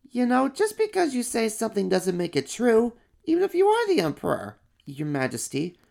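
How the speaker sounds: high, curious little monster voice; an eager small kid-monster. Acted. First little monster asks about the fur color.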